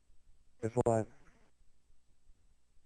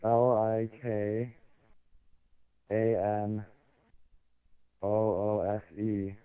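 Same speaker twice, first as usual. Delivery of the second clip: letter by letter, slow; spelling it out; slower than usual. Pretend. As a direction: spell each letter out slowly. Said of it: "Spell it".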